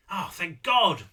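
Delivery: loud, relieved exclamation; relieved outburst; great relief. A narrator's reading. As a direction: let it out with a burst of relief. Character relieved to feel only distaste.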